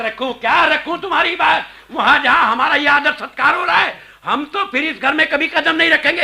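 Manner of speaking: angry. Acted. Offended guest vows never to return to the house, angry. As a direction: refuse hotly, full of offence. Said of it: "इतना गुस्सा ना कीजिए".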